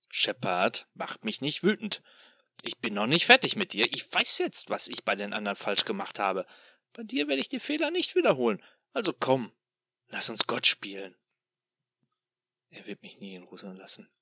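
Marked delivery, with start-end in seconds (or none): 0.1-11.2 loud, sneering demon voice; gloating tormentor taunting his captive; mocking demon. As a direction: toy with him like a cruel god. Demon threatens.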